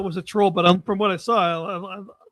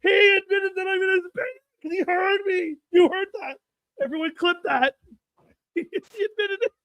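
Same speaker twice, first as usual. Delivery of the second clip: gleeful crowing; 10 semitones higher than usual. Real, not acted. Host teasing Adam to chat, high triumphant crowing. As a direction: rub it in, laughing. Gloating over cohost's slip.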